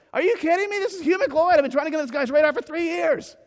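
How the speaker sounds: disbelieving, high and loud, thrilled; can't believe his idol wrote back; real excitement. Real, not acted. Marketer telling the audience his idol praised him, thrilled.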